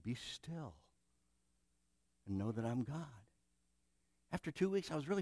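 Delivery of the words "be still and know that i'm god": hushed, gentle divine voice; soft voice of the Lord. Acted. God quietly repeating to the restless preacher, hushed. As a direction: murmur it like a calm command. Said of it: "the Lord kept saying".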